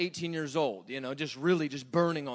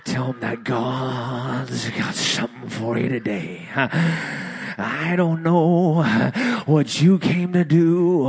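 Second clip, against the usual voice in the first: louder, slow, drawn-out revival preaching; Pentecostal preacher; 11 dB louder, much slower than usual. Retold or imitated. Preacher mimicking the revival meetings he grew up in, loud and slow. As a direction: belt it out like a fired-up Pentecostal preacher. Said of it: "I grew up in, like, a denomination".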